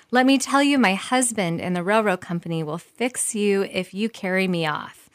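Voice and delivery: usual speaking voice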